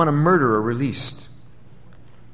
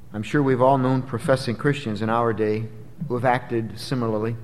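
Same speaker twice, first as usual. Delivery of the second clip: ordinary delivery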